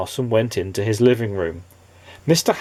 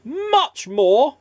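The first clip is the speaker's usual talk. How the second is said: wizard's cry. Acted. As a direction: egg him on. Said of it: "cried the wizard".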